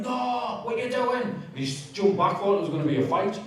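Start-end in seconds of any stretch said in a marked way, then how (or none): 0.0-1.2 retold scream. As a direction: re-enact a frantic cry to stop. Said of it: "And I ran up screaming".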